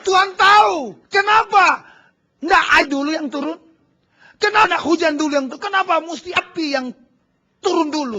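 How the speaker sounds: loud, high rhetorical questions; fiery preaching. Acted. Pastor challenging the church, loud preaching questions. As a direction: hammer each question at the room.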